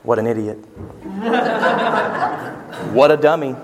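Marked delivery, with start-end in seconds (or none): 0.0-0.6 chuckling as you give the blunt meaning; deadpan punchline through a laugh; amused blunt aside; laughing translation. Retold or imitated. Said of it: "let me translate for you". 1.0-2.5 short real chuckle at his own joke; amused chuckle; laugh. Real, not acted.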